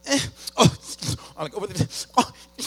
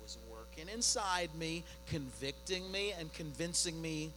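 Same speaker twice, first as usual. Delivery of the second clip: much softer, hushed and earnest; quiet reflective preaching; 12 dB quieter than usual. Acted. Pastor softly tells the church how God convicts him. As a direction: let it drop to a hush to draw them in.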